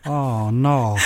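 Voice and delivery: normal talking voice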